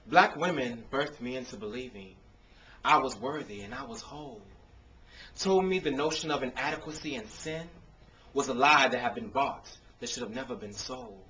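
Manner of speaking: rhythmic performed poetry with punchy builds; spoken word poem. Acted. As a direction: perform it like a slam poem on an open mic stage.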